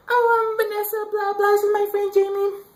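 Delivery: very high mock girl voice; high-pitched impression of a girl introducing herself; girly voice. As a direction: chirp it like a bubbly teen girl. Girl's voice in retold chat.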